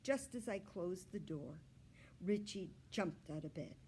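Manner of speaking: plain reading voice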